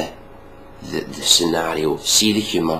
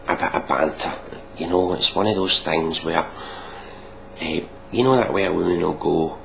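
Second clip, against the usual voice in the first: ordinary talk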